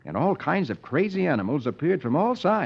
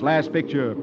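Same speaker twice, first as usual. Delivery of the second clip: plain reading voice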